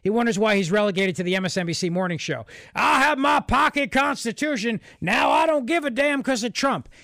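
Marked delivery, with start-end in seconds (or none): 2.8-6.9 mocking Southern accent. Host parodies pundit's jab at conservatives, mocking Southern accent. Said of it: "And he's mocking".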